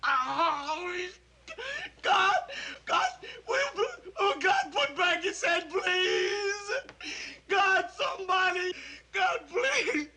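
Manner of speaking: horrified sobbing. Acted. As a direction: beg through sobs over a dead friend. Sees dead friend's head.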